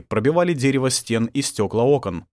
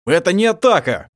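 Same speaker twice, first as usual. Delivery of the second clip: loud, high angry retort; heated, raised voice; 9 semitones higher, 7 dB louder than usual. A narrator's reading. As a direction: snap it out in anger. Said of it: "зло произнёс Мирадор".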